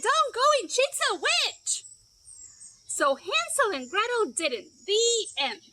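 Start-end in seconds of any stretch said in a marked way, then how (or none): 0.0-1.8 high, loud warning; urgent chick shout. Acted. Chicken warning Hansel and Gretel, high loud shout. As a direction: yell the warning to save them. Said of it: "out jumped a little red chicken".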